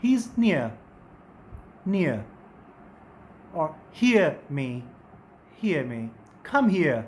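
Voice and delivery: near, hear and here slow, ee gliding to uh; teacher modelling "near" and "hear"; diphthong demo. Shown as a demonstration. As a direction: draw out hear from ee into uh.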